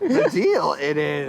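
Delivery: high, loud comic mash-up voice; Yoda Seinfeld. Acted. Comic voicing Yoda Seinfeld, high hammed-up impression. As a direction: squeal it as an exaggerated impression, half laughing.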